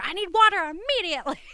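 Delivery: high, fast mock demand; a customer barking for water, hammed up.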